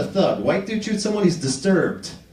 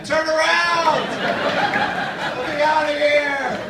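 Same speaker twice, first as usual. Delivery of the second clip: mock trapped shout; 8 semitones higher, much slower than usual. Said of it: "a homeless guy in the trunk shouting".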